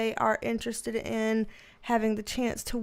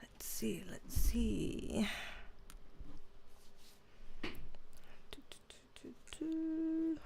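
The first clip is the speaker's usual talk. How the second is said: soft unvoiced mutter under her breath; whispering to herself; 15 dB quieter, slower than usual. Real, not acted. Streamer checking her setup, whispered to herself. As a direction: whisper it to yourself while fiddling with the stream.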